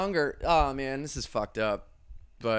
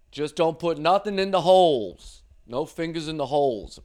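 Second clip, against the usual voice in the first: bouncer rules; 5 semitones higher, 8 dB louder than usual. Retold or imitated. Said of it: "He goes".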